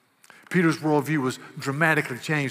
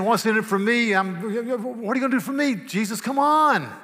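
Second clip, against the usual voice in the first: selfish Peter; 7 semitones higher, 4 dB louder than usual. Acted. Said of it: "He's saying".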